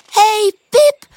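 high, loud, cheery little voices; happy call. A narrator's reading. Tulips greeting Pip as she arrives, happy call. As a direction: call out gladly in a tiny flower voice. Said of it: "roepen de tulpjes blij".

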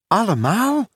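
high surprised question, swooping upward. A narrator's reading. Michael hears all his plasters are needed, surprised. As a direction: query it in surprise with a rising lift. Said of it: "zei Michael verrast".

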